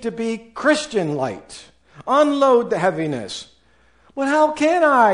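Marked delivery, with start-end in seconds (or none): 4.1-5.1 much higher and louder, a hammed-up pleading objection; protesting question. Listener asking the pastor how they can change, high pleading protest. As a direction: throw it back like an exasperated listener. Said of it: "you ask me".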